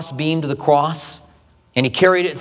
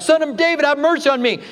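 calling out for mercy; 10 semitones higher than usual. Retold or imitated. Blind beggar calling out to Jesus.

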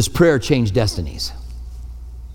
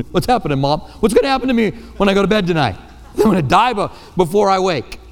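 put-on a child asking mom, higher and fast; lightly voiced worried kid, half laughing; a scared child's voice; 6 semitones higher than usual. Child asking his mom about dying, lightly mock-scared.

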